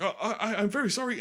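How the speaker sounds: high, giggling apology; sheepish apology. Retold or imitated. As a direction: squeak out a flustered sorry. Guy caught, apologizing.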